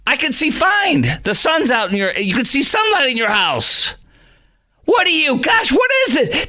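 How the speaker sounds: high-pitched, incredulous protest; exasperated. Acted. Host arguing with woman in clip, exasperated. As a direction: argue back in a high exasperated voice.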